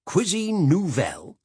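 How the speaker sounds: mock the words Cuisine nouvelle with scorn; disdainful. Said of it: "he said disdainfully".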